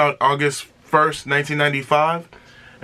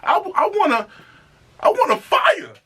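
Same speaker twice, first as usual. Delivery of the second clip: rising higher and higher with hype; getting amped; 10 semitones higher than usual. Real, not acted. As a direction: climb into excitement line by line. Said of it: "just get you amped".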